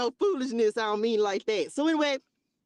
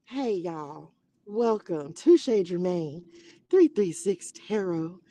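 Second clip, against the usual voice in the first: put-on old reserved persona voice; 3 semitones lower, slower than usual. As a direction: do my old stiff shop greeting. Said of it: "That's how I used to talk".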